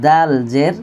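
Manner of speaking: ordinary talk, nothing marked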